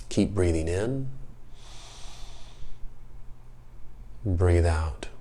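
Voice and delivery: slow, soft meditation voice with long pauses; guided meditation. Acted. Host leads children through slow breathing, calm guided-meditation voice. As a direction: guide a breath calmly, letting each word settle.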